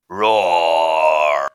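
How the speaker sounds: low, loud, drawn-out roar; loud bear roar. Acted. Sound effect played back for the class, loud low roar. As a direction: give a big hungry-bear roar. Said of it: "Right, that's awful".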